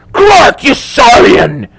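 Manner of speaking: high, loud, drawn-out yell; explosive high-pitched curse at an enemy; furious shout. A narrator's reading. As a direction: cry out in outraged shock. Ezekiel discovers his enemy alive.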